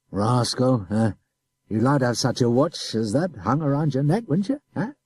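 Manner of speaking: coaxing officer dangling a prize; tempting adjutant. A narrator's reading. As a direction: tempt the boy.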